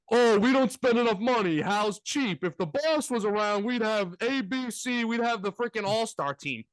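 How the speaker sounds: mock a spoiled Yankee fan, a hint of an Italian accent; a light parody of a fan complaining about cheap owners. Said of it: "I'm trying to be your stereotypical Yankee fan".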